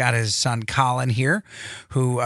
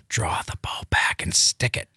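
urgent hushed whisper; 8 semitones lower than usual. Retold or imitated. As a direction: whisper the order to shoot. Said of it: "I'm like".

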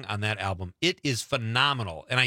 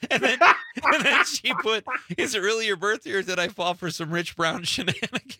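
laughing; 9 semitones higher, 4 dB louder than usual. Real, not acted. Laughing while reading a comment.

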